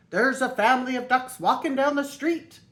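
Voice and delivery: Michael phoning Clancy at headquarters, urgent; urgent phone report. A narrator's reading.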